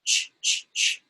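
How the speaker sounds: unvoiced ch, ch, ch repeated; ch sound. Shown as a demonstration. Teacher showing children the ch sound, crisp and unvoiced. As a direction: make the ch sound by itself. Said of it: "I'm going to make ch".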